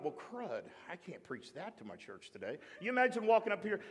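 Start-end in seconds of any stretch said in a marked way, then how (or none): 0.0-2.6 low, hushed thought to himself; dismayed muttering. Retold or imitated. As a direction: mutter it like a deflated private thought. Retells his dismayed private thought.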